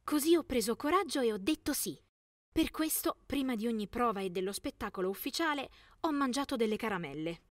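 ordinary delivery, nothing marked

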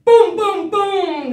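big loud booms; booming. Pretend. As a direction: boom it out loud. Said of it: "booming sound".

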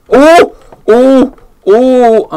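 high loud startled cries; frightened yelps. Real, not acted. Player startled by the ghost girl, loud yelps. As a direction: yelp in real fright. Scared by game monster.

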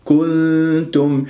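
slow "كنتم" with a long hummed n before the t; hidden noon. Shown as a demonstration. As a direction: hold the concealed nasal in "كنتم". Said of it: "وهي نون تاء إخفاء".

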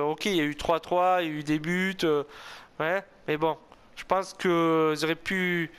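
ordinary talk

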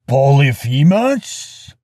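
loud, slow giant's call; great booming yell. A narrator's reading. Neighbouring Cyclops calls from outside the cave, great booming yell. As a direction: boom the name out as a long, rising question. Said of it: "yelled a great voice".

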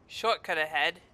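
ordinary delivery

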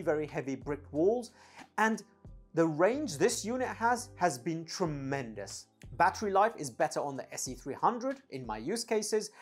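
ordinary talk

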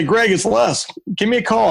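imitating Les. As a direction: sing out the name like a mentor leaving a message. Voicing mentor Les's voicemail.

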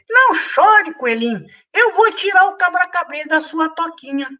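very high squeaky little voice; tiny mosquito voice. Acted. Tiny mosquito comforting the bunny, high squeak. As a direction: speak like a tiny bug.

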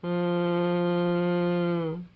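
soft, long monotone hum; robot snore. A narrator's reading. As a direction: snore as one steady mechanical drone. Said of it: "snored Robot".

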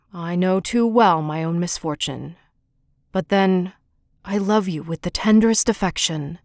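low, heavy monster voice, pleading gently; a lonely beast asking to be liked; gruff Beast voice. A narrator's reading. The Beast asks Beauty if he seems ugly, low and humble. Beast declares his love.

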